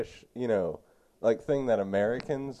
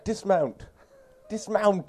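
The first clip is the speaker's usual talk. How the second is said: higher, slightly louder imitation of the actor's line; mock British call; 10 semitones higher, 4 dB louder, slower than usual. Friend mimicking the banister-slide line with a higher mock call. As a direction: sing it out like a posh lad on a banister. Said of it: "he was like".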